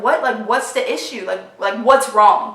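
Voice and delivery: irritated snap. Retold or imitated. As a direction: bite back, impatient. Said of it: "snap a little bit".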